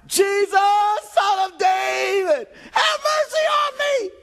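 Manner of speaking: slow, high, loud pleading shout; desperate cry. Acted. Blind beggar crying out to Jesus, desperate shout. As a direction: call out to Jesus as if your life hangs on it. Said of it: "he was crying out".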